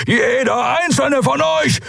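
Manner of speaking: high-pitched, full-throated roar; thundering. A narrator's reading. Keru threatens every captor, roaring. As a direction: roar the threat at all of them. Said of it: "donnerte Keru".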